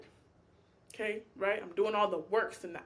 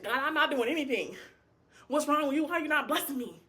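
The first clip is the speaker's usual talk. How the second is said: entitled whining; 4 semitones higher than usual. Retold or imitated. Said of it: "coming up to you and saying".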